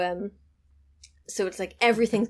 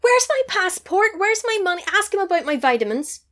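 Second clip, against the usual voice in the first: high, loud frantic woman's voice; panicked mock shriek; 11 semitones higher, 5 dB louder than usual. Woman frantically searching her room for her passport, shrill panic. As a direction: she has lost everything, so it goes up in panic.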